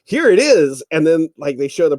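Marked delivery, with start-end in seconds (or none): 0.0-0.8 bright, louder pitch-up pitch line; infomercial host voice. Retold or imitated. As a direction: present it like a TV salesman.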